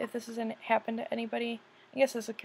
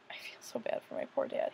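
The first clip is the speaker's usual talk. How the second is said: whispering; 3 semitones higher, 5 dB quieter than usual. Real, not acted. Sad confession about her dad.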